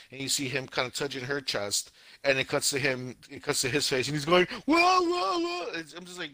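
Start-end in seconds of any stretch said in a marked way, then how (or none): 4.6-5.7 high, loud cartoon "whoa" wails; hammed-up freak-out cries; mock panicked yelping. Scene cuts to the guy's face, mock panicked yelping.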